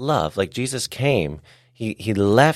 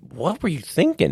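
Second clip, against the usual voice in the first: mock disbelief; 8 semitones higher than usual. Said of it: "I might be like".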